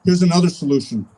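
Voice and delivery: ordinary talk, nothing marked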